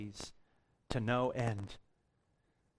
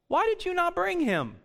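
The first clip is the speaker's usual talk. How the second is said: much higher, sharp indignant demand; indignant Pharisees; 13 semitones higher than usual. Acted. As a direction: fire it up as an outraged question.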